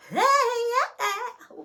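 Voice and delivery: very high, loud jubilant holler; real ecstatic outburst; excited whoop. Real, not acted. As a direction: whoop it out mid-praise. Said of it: "Okay, I'm excited".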